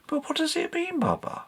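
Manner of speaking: Katy, eager question. Acted. Katy asking Baba about the inscription, soft high voice. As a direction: ask it high and hushed, keen to know.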